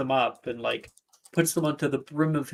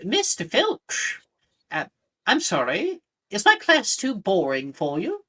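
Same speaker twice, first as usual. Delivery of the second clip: a squeaky stern teacher; Professor Flitwick voice; 6 semitones higher than usual. Acted. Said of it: "Flitwick says".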